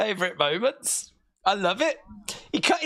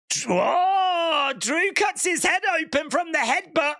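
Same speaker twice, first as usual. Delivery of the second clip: excited; 7 semitones higher than usual. Real, not acted. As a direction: call it like live commentary. Excited commentary on a headbutt.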